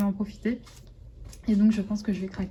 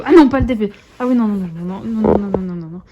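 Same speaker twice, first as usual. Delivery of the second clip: loud panicked no, no, no; loud protest; 10 dB louder than usual. Real, not acted. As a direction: stop the puppy from wrecking the canvas. Puppy attacks her diamond painting. (crie non au chiot qui attaque le D P)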